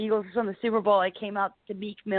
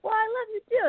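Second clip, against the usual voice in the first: much higher, playful mock-sweet voice; mock sweet reply. Warren Sapp answering the fan, mock-sweet and high. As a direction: tease it back sweetly.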